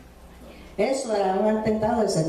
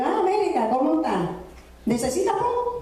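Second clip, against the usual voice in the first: bright, much higher friendly greeting, rising to a squeak on the offer; high, sing-song friendly voice; cheerful classmate; 6 semitones higher than usual. Retold or imitated. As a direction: play the surprised classmate who happens by and offers a ride. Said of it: "me dijo".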